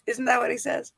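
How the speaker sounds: ordinary delivery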